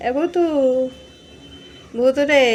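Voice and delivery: calling her cat Bhutu from the kitchen. Real, not acted. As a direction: call the cat's name out across the room.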